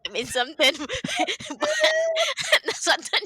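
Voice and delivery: laughing so hard the voice cracks high. Real, not acted. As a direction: crack up while telling it. Laughing hard at own dream.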